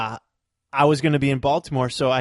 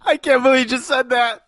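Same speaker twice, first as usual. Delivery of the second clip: laughing; 14 semitones higher than usual. Real, not acted. Reacting to cohost's line.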